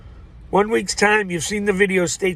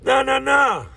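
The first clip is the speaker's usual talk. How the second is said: shout; 11 semitones higher, 5 dB louder, slower than usual. Real, not acted. Opening outburst, shouting no.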